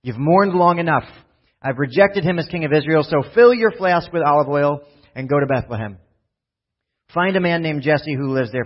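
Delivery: reading God's words out with a lift; scripture reading. Acted. Preacher reads God telling Samuel to move on. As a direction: read the Lord's command with weight.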